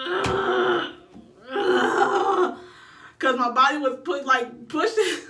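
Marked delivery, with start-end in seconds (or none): 0.1-2.6 high laughing push grunts; high strained grunts. Retold or imitated. Mother in labour grunting as her body pushes on its own, high strained grunts. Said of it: "And I'm like".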